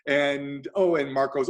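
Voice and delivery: ordinary talk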